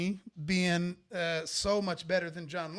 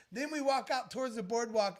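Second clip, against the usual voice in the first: usual speaking voice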